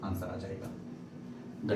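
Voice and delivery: whispered, slow, to himself; whispered aside. Real, not acted. Teacher to himself after the answer, whispering slowly. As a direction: let it drop to a slow whisper.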